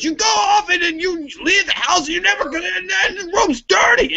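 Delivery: high-pitched mock-angry tirade; hammed-up dad rant, words tumbling out. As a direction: imitate a parent yelling in a high voice. Dad ranting, retold.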